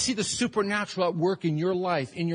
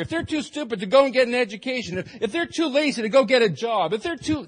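contemptuous rant, higher and louder; scornful adults; 5 semitones higher, 6 dB louder than usual. Acted. Adults scorning those who forgot lunch, loud contempt. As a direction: sneer at the needy, building scorn. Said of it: "the adults who actually packed the lunch are saying".

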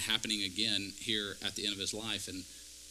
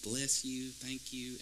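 a hint of meek politeness, much softer; Ned Flanders; 8 dB quieter, much slower than usual. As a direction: lightly mimic a harmless, over-polite churchgoer, softly. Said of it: "this Ned Flanders existence".

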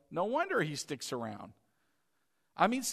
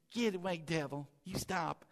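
timid rebuke; 6 dB quieter than usual. Retold or imitated. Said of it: "I quote".

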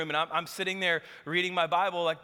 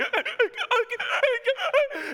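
nervous laugh. Retold or imitated.

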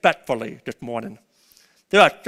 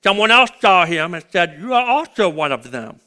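preaching at full force; 3 semitones higher, 5 dB louder than usual. Acted. Preacher to church, telling Peter's denial in a loud preaching voice. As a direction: retell the denial scene, preacher to congregation. Preaching the gospel account aloud.

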